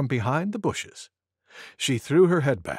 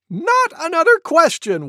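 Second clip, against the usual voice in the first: high, loud cuckoo wail; 7 dB louder than usual. A narrator's reading. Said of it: "wailed Cuckoo".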